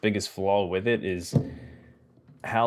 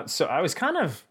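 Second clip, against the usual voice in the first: ordinary talk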